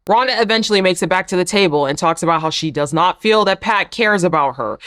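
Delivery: normal talking voice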